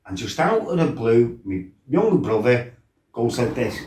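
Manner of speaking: usual speaking voice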